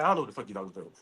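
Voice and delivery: low, soft muttered comeback; mock muttered reply. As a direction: mutter it under your breath. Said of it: "I'm like".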